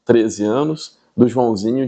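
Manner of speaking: ordinary delivery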